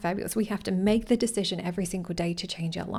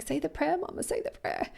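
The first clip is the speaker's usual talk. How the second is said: put-on much higher, childlike urging; daughter's voice; 8 semitones higher, 5 dB quieter than usual. Daughter reminding mum to say the prayer, high and childlike. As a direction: nag sweetly like a small girl. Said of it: "she's like".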